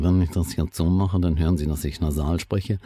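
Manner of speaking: strongly nasalized speech; nasal. Shown as a demonstration. Voice researcher showing what nasal speech sounds like, nasal. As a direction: talk through the nose. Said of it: "Ich kann zum Beispiel nasal sprechen".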